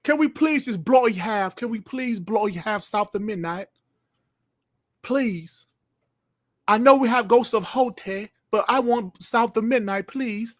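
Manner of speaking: high-pitched, hammed-up bad British accent; mock British begging, high and put-on; terrible British impression. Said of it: "doing my terrible British impression".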